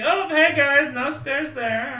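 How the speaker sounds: high cheerful call. Acted. Character calls out cheerfully.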